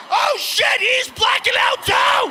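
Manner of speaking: high, loud panicked yell; shouting high in sudden alarm. Retold or imitated. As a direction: alarm as the pilot fades beside you. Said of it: "I'm going".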